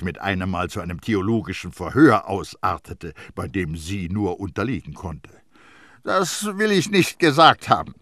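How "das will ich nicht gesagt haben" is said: raised, defensive denial; dodging the question; evasive. A narrator's reading. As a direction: back away from the claim, pitched up. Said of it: "antwortete sie ausweichend".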